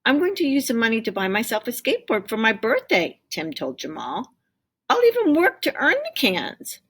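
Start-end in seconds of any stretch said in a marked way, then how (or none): 0.0-3.2 put-on quick, bright little boy; child's voice. A narrator's reading. Tim telling Jamal his birthday plan, fast child voice. Same line earlier. 4.9-6.8 put-on high, eager little boy; child's voice. A narrator's reading. Tim pleading with Jamal for the cans, high child voice.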